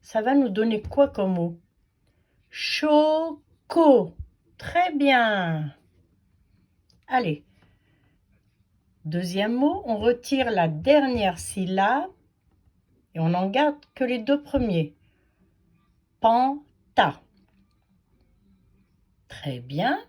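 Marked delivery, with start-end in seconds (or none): 2.5-4.1 "Choco" as two drawn-out, higher syllables. Shown as a demonstration. Teacher reading what's left of the word, "Choco" syllable by syllable. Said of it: "à les découper en syllabes". 16.2-17.2 "Panta" in two separated beats; syllable by syllable. Shown as a demonstration. Teacher reading the first two syllables, "Panta" split in two. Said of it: "à les découper en syllabes".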